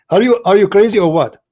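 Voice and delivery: loud, high, incredulous challenge; exasperated outburst. Real, not acted.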